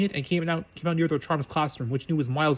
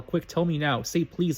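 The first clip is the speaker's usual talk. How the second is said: usual speaking voice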